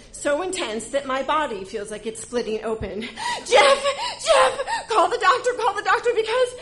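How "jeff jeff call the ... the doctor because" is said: high, loud frantic plea; laughing panic shout; panicked yelling. Retold or imitated. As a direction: scream at him to call the doctor. Labor contraction, panicked call.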